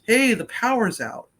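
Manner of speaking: mock hail from across the driveway; neighbor calling over. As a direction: call it out as fresh news.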